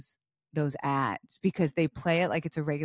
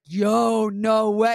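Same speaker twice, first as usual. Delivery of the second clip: excited; 2 semitones higher, 7 dB louder, much slower than usual. Retold or imitated. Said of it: "he was like".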